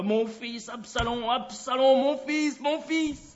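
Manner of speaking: appels hauts et forts qui montent, pleins de douleur; cri de deuil. A narrator's reading. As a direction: crie ton fils perdu à haute voix. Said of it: "il criait à haute voix". (lamentation criée à pleine voix)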